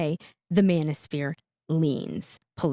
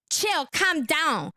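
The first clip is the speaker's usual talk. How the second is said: slow, higher mock of her "chill, calm down"; mocking imitation; 6 semitones higher, much slower than usual. Host mocking the woman telling Andrew to calm down, slow and higher. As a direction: stretch it out, lightly mocking her. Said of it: "Joel, calm down".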